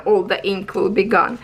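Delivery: usual speaking voice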